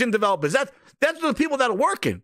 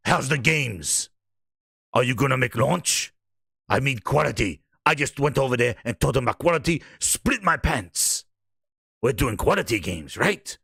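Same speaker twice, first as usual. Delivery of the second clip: much lower, measured boss-man delivery; mock boss voice; 13 semitones lower than usual. Visiting boss asking staff about the games, low mock-important voice.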